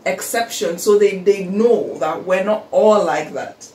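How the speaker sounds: ordinary talk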